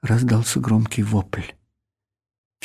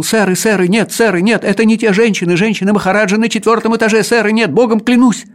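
high, loud, rushed gabble; frantic patter; 10 semitones higher, 6 dB louder, faster than usual. Acted. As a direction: blurt it all out in one breathless rush. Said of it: "жуткой скороговоркой выпалил".

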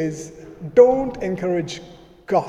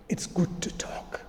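whisper; 10 dB quieter than usual. Real, not acted. Sermon point, softened.

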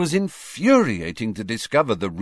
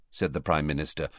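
plain reading voice

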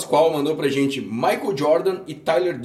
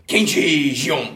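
put-on Japanese accent; much slower than usual. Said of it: "com meu sotaque japonês".

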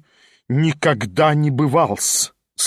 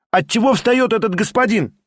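higher, sharp cry; indignant shout; shouted question; 7 semitones higher than usual. A narrator's reading. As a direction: call out across the room. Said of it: "крикнула студентка".

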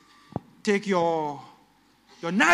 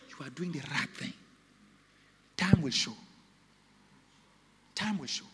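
ordinary delivery

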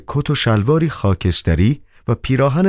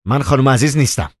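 higher, fast cutting retort; 7 semitones higher, faster than usual. Acted. Doorwoman cuts Koroviev off, higher and fast. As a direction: snap back interrupting. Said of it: "زن دوید وسط حرفش".

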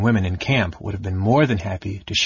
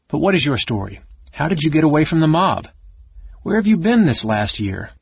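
higher, insistent questions; urgent questioning; 6 semitones higher, 4 dB louder than usual. A narrator's reading. Michael asking how his cousin escaped the mob, urgently. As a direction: press for answers, pitch and volume lifting.

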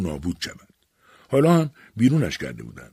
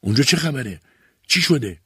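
high, urgent questions in shock; frantic alarm; 6 semitones higher, 5 dB louder than usual. A narrator's reading. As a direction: demand answers in fearful bewilderment. (با حیرت و ترس، سراسیمه)

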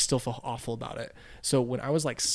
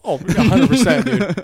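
much louder, laughing yes; cracking up while agreeing hard; loud laughing agreement; 8 semitones higher, 14 dB louder than usual. Real, not acted. Guest laughs loudly agreeing with host he was a jerk.

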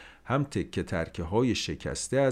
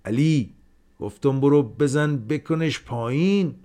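raised, commanding call outward; shouted order; 6 semitones higher, 6 dB louder than usual. A narrator's reading. Haji calling outside to Ali about the rooster, firm shout. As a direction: call out to the boy to knock it down. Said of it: "فریاد زد".

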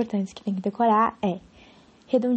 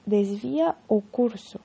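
syllables drawn out; slower than usual. Shown as a demonstration. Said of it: "Aí, nós vamos ter".

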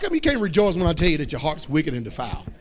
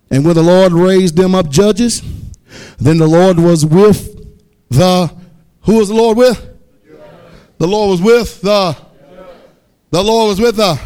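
preaching at full force; 7 dB louder than usual. Acted. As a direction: read it out like a proclamation, stretched. Preacher reading scripture loudly.

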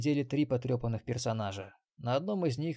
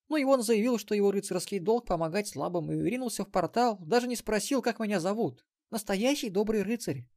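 put-on much higher bright girl voice; young girl; 8 semitones higher than usual. Granddaughter tells of the knight.

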